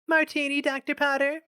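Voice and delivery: high, bright-pitched serving maid. A narrator's reading. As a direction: offer the drink in a high maid's voice. Maid offers a tray.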